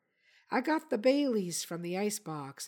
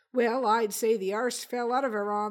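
put-on Newfoundland accent; 5 semitones higher, 7 dB louder than usual. Narrator in Newfoundland idiom.

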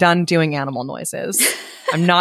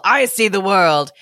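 put-on bull character; slower than usual. A narrator's reading. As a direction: say it as a storybook bull. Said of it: "said the bull".

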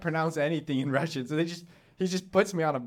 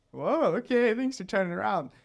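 mock delight; 8 semitones higher than usual. Retold delight at compliment.

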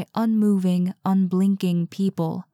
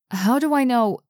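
panicked question; 5 semitones higher than usual. A narrator's reading.

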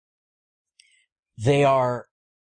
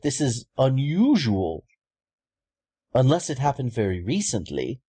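put-on a lightly raised, measured voice of an old order's elder; Fetra, solemn elder; 4 semitones higher than usual. GM plays Fetra questioning Eleanor.

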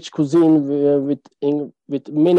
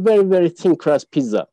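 ordinary delivery

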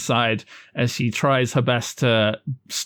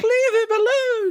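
put-on very high child's yell; protesting little girl. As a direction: shout in a tiny girl's high voice. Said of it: "the little girl ... being like".

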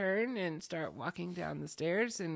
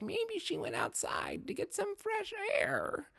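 high, slow, eerie witch voice; creepily drawn-out, feigned helpfulness; Missus Bigley; 12 semitones higher than usual. Acted. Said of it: "And Missus Bigley is like".

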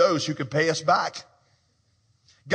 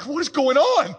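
much higher, astonished outcry; stunned crowd; 11 semitones higher, 4 dB louder than usual. Retold or imitated. Courtroom crowd reacting to the pardon, much higher and astonished.

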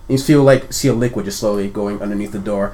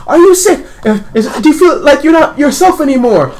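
high, loud worried questions; frantic father; 6 dB louder than usual. Acted. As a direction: fret urgently over your child. Father frantically questions his son.

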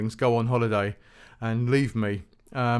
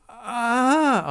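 long, high "ah" of discovery; acted pleasant surprise; mock realisation; 9 semitones higher, 3 dB louder than usual. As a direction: draw out a high "ahh", as if it just dawned on you. Acts out discovering tracks in inbox.

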